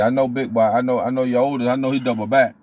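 ordinary talk

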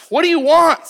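high, loud and curt, with stank on it; what do you want, barked with an edge; sharp demand. Shown as a demonstration. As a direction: snap the question like an irritated stranger. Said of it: "That doesn't sound very sweet".

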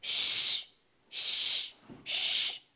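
fading hissed shh; snake hiss. Acted.

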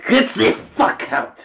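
loud, high, drawn-out yell; outraged shout. Acted. Ordering the producer out of the room, outraged shout. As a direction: bellow it in mock outrage.

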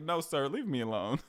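laughing through a mock plea; half-laughing, pushing him off; amused brush-off, giggling. Retelling the cop encounter, amused.

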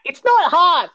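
high whiny upset protest. Retold or imitated. Mate complaining to friends, high whiny voice. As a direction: whine it out, mad but hurt. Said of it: "this kinda whiny, upset".

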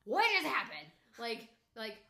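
high, loud urgent questions; loud questioning. Retold or imitated. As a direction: demand an answer over the noise. Mother yelling at panicked son.

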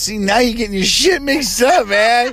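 high put-on British accent; mock British accent. Host telling partner she's mixed up, mock British accent. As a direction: scold playfully in mock British.

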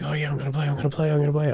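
high teasing sing-song; toy teasing. Shown as a demonstration. Owner shaking the toy and teasing in a high voice. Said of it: "I'll be like".

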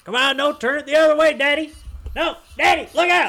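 high, loud mock holler at Daddy; imitated yelling. Son yelling at his dad backing the boat, imitated high shout. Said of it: "yelling at his eighty-year-old dad".

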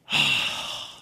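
big breathy fed-up sigh; exasperated sigh. Shown as a demonstration. Preacher voicing Solomon, ending in a fed-up sigh. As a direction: exhale all the frustration in one sigh. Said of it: "just makes me wanna go".

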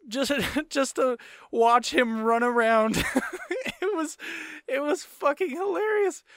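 high-pitched, cracking up mid-sentence; laughing while talking. Real, not acted. Recalling a stunt on his friend Mikey, laughing. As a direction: tell it through real laughter, pitch climbing.

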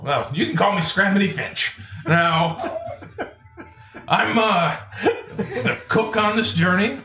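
put-on high, hearty old man voice; old wagon driver. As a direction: reassure the newcomers like an old cook. Driver introduces his job.

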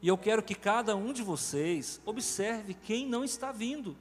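ordinary delivery

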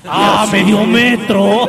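shouted catchphrase. Acted. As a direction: belt it out like a radio catchphrase.

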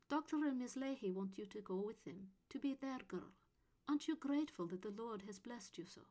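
a hint of a stern nun; lightly voiced strict nun, half whispered; Mother Superior voice. A narrator's reading. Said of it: "the Mother Superior said".

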